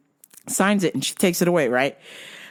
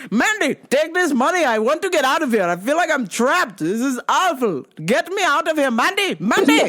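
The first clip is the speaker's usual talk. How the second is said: high, pleading dad voice; hammed-up panic, calling for the waitress; desperate dad; 8 semitones higher than usual. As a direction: imitate dad begging to escape. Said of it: "My dad's like".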